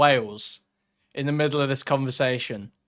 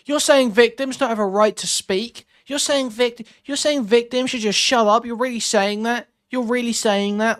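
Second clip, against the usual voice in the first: higher, mocking imitation of her shrill outrage; mock screeching harpy; 8 semitones higher than usual. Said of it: "Like a screeching fucking harpy".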